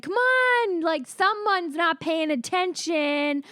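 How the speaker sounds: high, loud host voice; hosting hype. Retold or imitated. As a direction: rev up the crowd. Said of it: "And I said".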